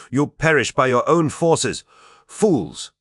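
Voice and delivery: high, loud enraged cry; angry shout. A narrator's reading. Meliadus chasing the fugitives, yelling in rage. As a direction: spit the threat after them.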